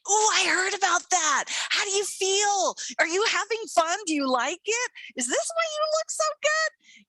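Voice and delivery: high, bright curious questions; eager admirers. Retold or imitated. Friends eagerly asking the carnivore grandmother about her diet, gushing. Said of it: "everybody's going".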